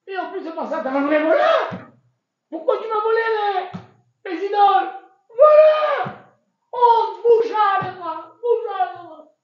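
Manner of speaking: high, loud furious shouting; furious rant. Acted. Laban yelling at Yaakov about the stolen idols, furious. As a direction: rant at the top of your lungs.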